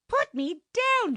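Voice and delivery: high, loud, slow scream; screaming at the brute to put her down. A narrator's reading. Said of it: "she screamed".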